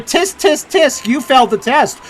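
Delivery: high, loud mock tutting; mocking scold. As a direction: voice the game as a smug scold. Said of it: "I like how the game keeps going".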